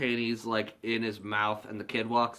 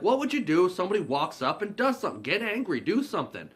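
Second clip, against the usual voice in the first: raised, higher voice, mock-coaching; urgent goading; 7 semitones higher, 4 dB louder, faster than usual. [name] teaching the kid to defend himself, urgent goading. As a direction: egg him on, fast at first.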